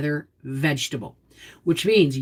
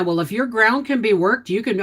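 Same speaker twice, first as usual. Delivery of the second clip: ordinary talk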